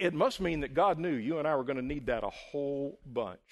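usual speaking voice

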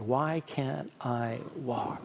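soft, very slow imitation of a crying child; understated sorrow, drawn out and hushed; retold tearful plea. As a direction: voice the boy's plea quietly, word by word. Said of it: "he just began to cry".

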